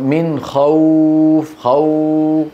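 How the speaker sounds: خوف with the "aw" glide held long; easy-flowing leen. Shown as a demonstration. Teacher shows how easily the sound flows, sustained leen. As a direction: recite slowly, stretching the w of خوف evenly. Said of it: "بسبب سهولة جريهما في المخرج".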